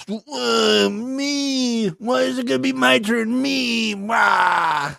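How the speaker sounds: high, drawn-out whiny wail; mock crying. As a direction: bawl like a hammed-up crybaby. Mocks Anissa whining about her turn.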